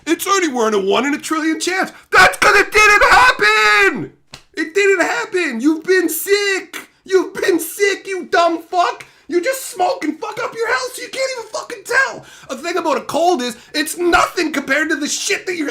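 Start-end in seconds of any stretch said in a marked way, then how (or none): 2.1-12.2 high, loud, slowed yelling; furious shout. Real, not acted. Streamer screaming at a viewer who says he never gets sick. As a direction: hammer each word in anger.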